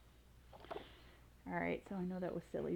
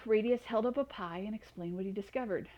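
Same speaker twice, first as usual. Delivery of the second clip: ordinary delivery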